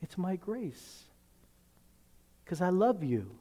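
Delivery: soft, slow and gentle; Jesus answering the unworthy believer, soft and tender. Retold or imitated. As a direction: comfort the sinner quietly. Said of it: "Jesus says".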